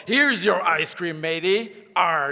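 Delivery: lightly voiced pirate line; mild hammed-up pirate. Shown as a demonstration. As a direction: hand it over like a friendly stage pirate. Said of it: "what was the line?".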